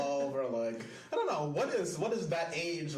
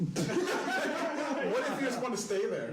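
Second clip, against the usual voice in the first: laughing; 8 semitones higher, slower than usual. Real, not acted. Laughing while asking.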